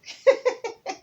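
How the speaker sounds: high scornful laugh; mocking laugh. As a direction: laugh off the little tortoise. Hippo laughs at tortoise.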